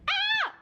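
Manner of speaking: very high loud squeal of joy; high fangirl squeal; excited squeal. Real, not acted. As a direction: let out a thrilled shriek. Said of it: "So I'm so excited".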